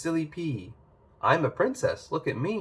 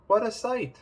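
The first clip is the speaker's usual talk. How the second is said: amazed; 10 semitones higher than usual. Acted. Jack amazed at beanstalk.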